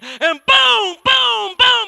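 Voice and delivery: loud high boom sound effect; shotgun boom. Retold or imitated. The wife fires the shotgun at him, loud mock booms. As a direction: bellow each boom like a blast.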